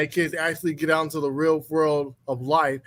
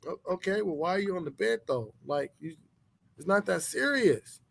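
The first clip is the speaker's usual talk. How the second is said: calm husband; 8 dB quieter than usual. Retold or imitated. Husband calmly answers wife.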